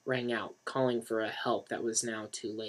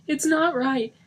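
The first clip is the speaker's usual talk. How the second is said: high, louder anguish; 7 dB louder, slower than usual. Acted. Denny clutching Johnny's chest, anguished. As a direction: sob it out.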